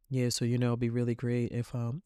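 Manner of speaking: low, quiet, disengaged mumbling act; uncaring podcaster. Acted. Podcast host losing the audience, acted low and uninterested delivery. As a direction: trail along softly as if you don't care. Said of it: "She doesn't care about us".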